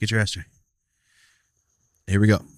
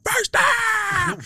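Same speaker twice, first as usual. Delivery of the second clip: high-pitched sports-fan holler; mock yell. Retelling a Chargers fan's cheer at the game, mock yell. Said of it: "Chargers guy yelling".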